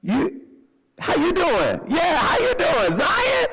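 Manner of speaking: hammed-up overeager hello; talkative greeter. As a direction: play the oblivious chatty friend. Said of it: "just like".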